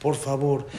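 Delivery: soft, pleading prayer through tears; hushed tearful pleading; tearful quiet plea. A narrator's reading. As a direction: beg God softly, close to crying. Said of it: "Empieza a llorar y a pedir tefilá".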